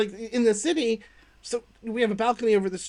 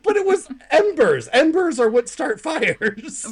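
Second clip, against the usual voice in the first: incredulous protest; 7 semitones higher, 4 dB louder, slower than usual. Retold or imitated. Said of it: "I'm like".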